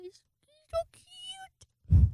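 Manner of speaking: very high-pitched adoring squeak; gushing squeal. Real, not acted. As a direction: squeal over an adorable pet. Gushing over giving a kiss.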